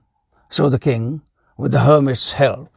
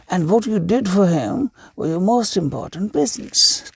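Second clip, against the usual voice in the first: higher, gentle sage explaining; wise old hermit; 6 semitones higher than usual. A narrator's reading. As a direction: teach the lesson patiently. Hermit delivers the moral.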